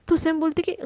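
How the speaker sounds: usual speaking voice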